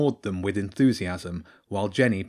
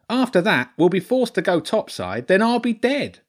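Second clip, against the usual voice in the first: high, loud, doom-laden rat lord; Lord Ratzenberg; 11 semitones higher, 4 dB louder than usual. Acted. Rat lord foretells his death to the children, high dramatic wail.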